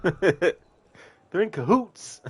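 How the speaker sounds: slow, drawn-out imitation of Alex; Alex's way of saying it. Streamer imitating a game character's line, drawn-out and slow. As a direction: stretch it out like Alex does in the game. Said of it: "I love the way Alex says that".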